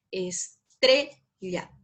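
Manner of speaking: "estrella" very slowly, es, TRE, lla; stress on the middle syllable. Shown as a demonstration. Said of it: "Se pronuncia nomás".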